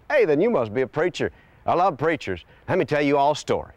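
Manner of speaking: light up at meeting a preacher; eager old man. Retold or imitated. Old man thrilled to meet a preacher, high and eager.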